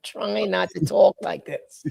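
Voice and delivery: put-on slow, high New York accent; mock New York accent. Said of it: "in that accent".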